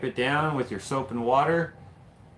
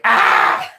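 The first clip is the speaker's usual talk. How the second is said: short loud mock scream; imitated scream; 12 dB louder than usual. Ranger imitating a raccoon with a mock scream. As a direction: belt out a quick fake scream. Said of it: "that probably sounds like".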